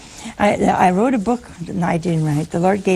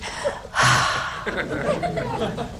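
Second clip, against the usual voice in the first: long airy sigh of relief, half laughing; big relieved sigh; 6 dB quieter than usual. Shown as a demonstration. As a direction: breathe out in great relief. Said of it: "She breathed one of these".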